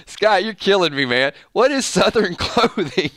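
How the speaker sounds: high-pitched, talking through laughter; cracking up in amused disbelief; real laughter. Real, not acted. Host cracking up at viewer's comment.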